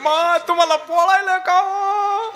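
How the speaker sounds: high, slow crying singsong; half-sung wail of a mourner; sing-song mock lament.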